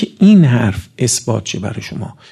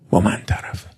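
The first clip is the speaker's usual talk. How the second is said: hushed warning; 6 semitones lower, 6 dB quieter than usual. Acted. Solemn warning closing a quote.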